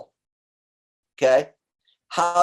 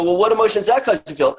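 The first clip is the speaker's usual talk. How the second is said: coaching question, higher and fast; 7 semitones higher, 3 dB louder, faster than usual. Retold or imitated. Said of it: "we go".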